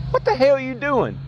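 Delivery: high, strained mock yell of an angry neighbor; imitating Dale's yell. Woken neighbor yelling at the man running a generator, high mock yell. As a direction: bark it out like a woken, furious driver.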